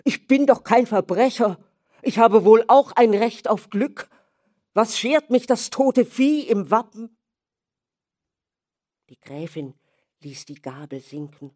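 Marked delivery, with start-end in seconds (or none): 0.0-7.2 loud, high, furious outburst; vehement outburst. Acted. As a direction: burst out at his mother in anger. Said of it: "sagte heftig". (heftiger Ausbruch)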